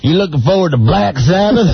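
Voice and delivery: high-pitched hammed-up Southern accent. Shown as a demonstration. Co-host shows Opie how his question should sound, hammed-up Southern accent. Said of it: "said that with a southern accent".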